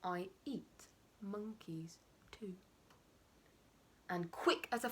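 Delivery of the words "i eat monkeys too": slow, hushed threat; menacing whisper. Acted. Crocodile whispers a threat to the monkey, slow. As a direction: whisper it slowly like a predator.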